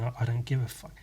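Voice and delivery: sheepish quiet echo of his own outburst; quiet sheepish repeat. Retold or imitated. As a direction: murmur it back, half-embarrassed. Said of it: "I just got done saying".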